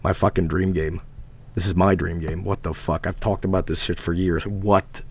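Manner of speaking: low, hushed amazement; stunned disbelief. Real, not acted. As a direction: mutter softly to yourself in disbelief. Reading about dream game, stunned.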